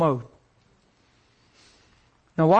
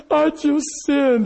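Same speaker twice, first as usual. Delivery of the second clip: high, drawn-out mock sob; bawling; 5 semitones higher, slower than usual. Husband confessing to his wife outside the men's room, bawling. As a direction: blubber it slowly, imitating crying. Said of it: "and you're bawling".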